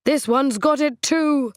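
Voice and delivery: indignant cry. A narrator's reading. Trent to Coral, pointing at the sandwich, slow indignant outcry. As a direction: declare it like finding poison.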